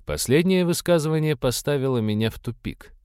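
plain reading voice

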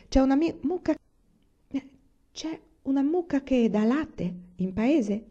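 a hint of a hopeful little girl asking Pa; Laura asking.

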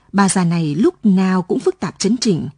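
plain reading voice

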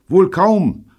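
high, loud reply; calling over radio. A narrator's reading. David answering Neil by radio, calling. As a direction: pitch it up to carry.

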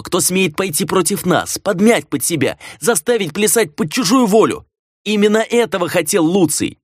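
high-pitched, impassioned accusations; trading furious charges over the clan; heated quarrel. A narrator's reading. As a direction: argue fiercely, pitch pushed up without much volume. Heated quarrel between brother and sister.

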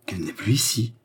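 disbelieving question, rising at the end; mildly alarmed echo of his words; appalled echo. A narrator's reading. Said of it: "s'écria Jeanne épouvantée".

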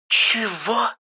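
резко заорать, очень высоко; сестра в шоке орёт в трубку; резкий крик. A narrator's reading. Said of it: "резко заорала она". (громкий резкий вопль)

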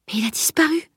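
high, soft protest; objecting. A narrator's reading. Girl objecting to her friend that the man vanished, protesting. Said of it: "objecta-t-elle".

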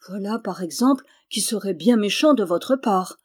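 plain reading voice

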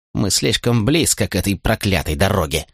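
put-on old woman's muttered grumble; muttering. A narrator's reading. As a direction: grumble it under your breath, in character. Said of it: "она пробормотала". (недовольное бормотание)

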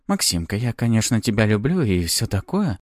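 ordinary delivery, nothing marked

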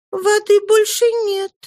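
put-on little girl's high, timid protest; shy girl daring to object; timid objection. A narrator's reading. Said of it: "робко возразила Казетта".

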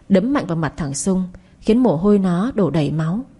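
ordinary delivery

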